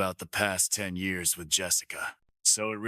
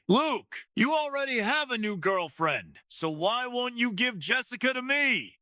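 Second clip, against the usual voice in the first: character voice. A narrator's reading. Jason's chilling voice confronting Luke.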